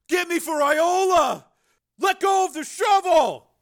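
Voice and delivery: high, loud, desperate yell; frantic shout. A narrator's reading. Crazed brother fighting for the shovel, frantic shout. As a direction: shout it in a frenzy.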